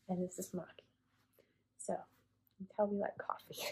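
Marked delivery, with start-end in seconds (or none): 1.8-3.6 soft whisper, amused; hushed coffee joke to viewers. Real, not acted. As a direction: whisper the punchline. Joke about cat names.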